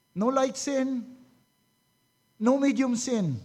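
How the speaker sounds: ordinary delivery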